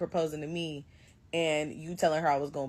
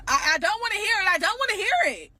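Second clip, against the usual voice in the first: loud and high, cutting him off; a bride yelling she won't hear his excuses; fed-up yell; 13 semitones higher, 5 dB louder than usual. Retold or imitated. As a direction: shout him down, not listening.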